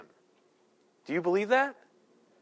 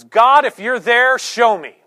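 loud, slow call to the sky; a cartoon ant calling out loud to God; retold shout; 5 semitones higher, 9 dB louder, slower than usual. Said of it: "and he shouts out".

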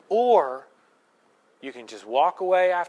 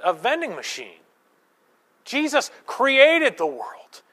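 usual speaking voice

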